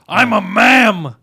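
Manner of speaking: mock drawn-out insistence. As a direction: drag it out indignantly. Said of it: "he's like".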